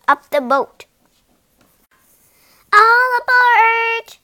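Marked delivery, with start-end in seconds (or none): 2.7-4.2 high, loud, slow call; mosquito crying out. A narrator's reading. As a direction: call all aboard like a captain. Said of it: "cried the mosquito".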